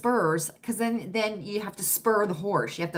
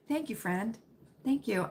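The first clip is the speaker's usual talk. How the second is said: usual speaking voice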